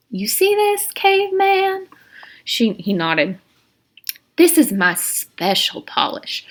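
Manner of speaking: very high, sugary and sinister; warden's voice. Acted. Warden to Stanley, very high creepy sweetness. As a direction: purr over the polish. Warden shows her polish.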